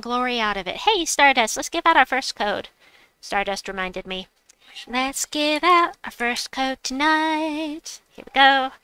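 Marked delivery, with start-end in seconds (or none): 4.9-8.0 slow, high, showy announcement; drawn-out announcement. Pretend. Streamer announces first code to viewers, drawn-out and showy. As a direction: stretch it out high like a show host. Repeats the line as performed announcement.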